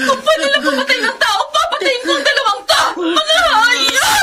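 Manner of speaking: high, raging shouts with sobs; furious screaming. Acted. Sister screaming at her sister's attackers in jail. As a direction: scream curses at them through tears.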